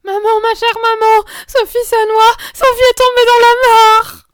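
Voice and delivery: put-on high, very loud child's scream; frantic child's shout; panicked cry. A narrator's reading. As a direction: scream for mother in desperation. Panicked child tells mother of drowning.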